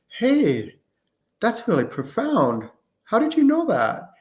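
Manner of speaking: high, swooping mock astonishment; impressed amazement. Friend hearing a cool message, amazed.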